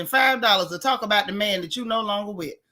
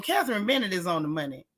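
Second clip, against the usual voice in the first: usual speaking voice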